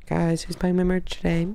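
normal talking voice

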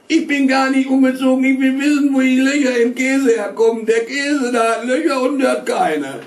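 put-on high, loud bawling child; boy bawling. A narrator's reading. Boy bawling back at his father at the table. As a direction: yell it up at your father. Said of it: "nicht so zu brüllen".

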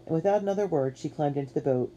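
ordinary delivery, nothing marked

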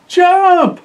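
high, loud cry to jump; sudden alarmed yell; urgent shout. Acted. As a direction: yell it as a warning. Urging drivers off pier.

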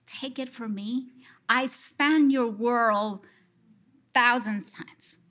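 ordinary talk, nothing marked

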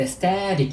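"static" with the second T softened; weakened T. Shown as a demonstration. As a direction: let the middle T in "static" go weak. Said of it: "이런 식으로 발음이 되죠".

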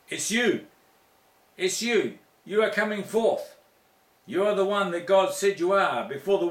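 high, loud, fervent sermon climax; full-force preaching. Acted. Preacher urging his congregation, loud and fervent. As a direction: build it like a revival preacher.

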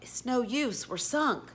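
high, mildly whimpering despair; understated tearful wail; sobbed. A narrator's reading. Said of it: "At last he sobbed".

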